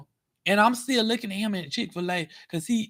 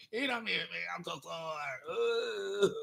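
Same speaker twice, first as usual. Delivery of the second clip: much softer, slow, feeble put-on injured voice; faint fake victim; 12 dB quieter than usual. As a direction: fade out like you're barely conscious. Pretend weak injured victim.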